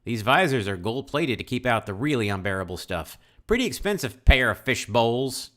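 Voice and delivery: put-on Southern accent, rising toward the end. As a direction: Alan bragging in a hammed-up Southern accent. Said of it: "this southern accent".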